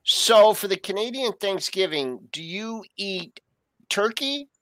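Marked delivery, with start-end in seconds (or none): none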